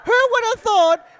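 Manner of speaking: loud, high ecstatic commentary; excited yelling. Real, not acted. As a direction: shout it out in disbelief. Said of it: "We've lost it here in the commentary box".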